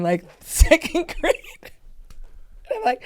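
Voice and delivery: high, slow giggle breaking through; laughing. Real, not acted. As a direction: laugh at your own younger self. Laughing at childhood memory.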